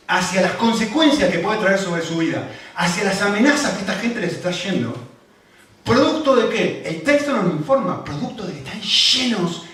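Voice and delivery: loud, high preaching, driving each point home; fervent preaching. Acted. Pastor preaching to the church at full force, loud and high. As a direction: preach it out to the congregation.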